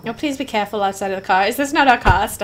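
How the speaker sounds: ordinary delivery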